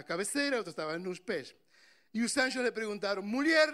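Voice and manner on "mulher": a sudden high, loud call of "Mulher"; loud angel call. Acted. Angels calling out to the weeping woman, loud high call. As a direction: call out to her like an angel, louder and higher.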